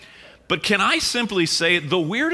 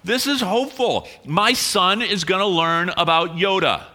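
excited; 4 semitones higher than usual. Retold or imitated. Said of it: "so I just felt like".

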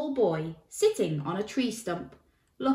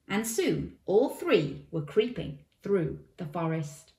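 plain reading voice

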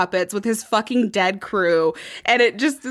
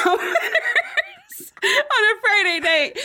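laughing; 13 semitones higher, slower than usual. Real, not acted. Telling a funny story.

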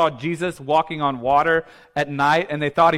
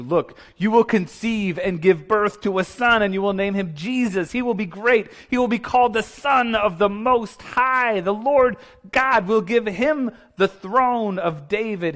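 raised, swelling angelic proclamation; exalted announcement; 6 semitones higher than usual. Acted. Angel telling Mary about her coming son, exalted proclamation. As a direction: build grandly through the promise.

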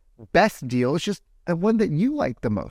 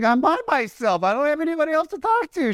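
retelling own reply in a much higher, fast, put-on voice; mock exasperated self; 13 semitones higher than usual. Telling a laughing tourist mom at the glacier, high put-on defensive voice. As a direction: play up the lonely traveller, pitched way up. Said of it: "I was like".

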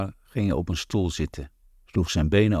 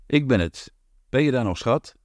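raised voice calling through the door, pitched up; husband calls out to his wife behind the locked door, raised voice; 6 semitones higher than usual. A narrator's reading. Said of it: "riep hij".

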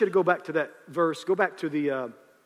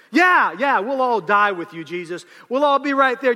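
mock eager agreement; 7 semitones higher, 9 dB louder than usual. Disciples eagerly agreeing, mocked.

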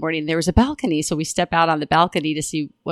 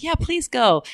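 high urging; 11 semitones higher, slower than usual. Retold or imitated. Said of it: "And I'm like".

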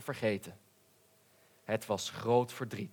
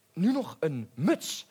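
elated; 5 semitones higher than usual. A narrator's reading. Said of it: "riep de zwaan opgetogen".

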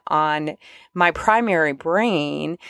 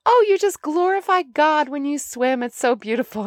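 higher, sweet admiring mom; lightly voiced mom; 9 semitones higher than usual. Mom praising her swimming, lightly voiced sweet gush. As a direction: gush like a proud mother. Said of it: "She would say that".

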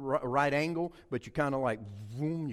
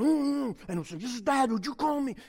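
higher, mock-furious strain; seething dad; 8 semitones higher, 3 dB louder than usual. Red-faced dad texting his kid, seething. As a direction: push it out after a frustrated hum. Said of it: "my face getting red".

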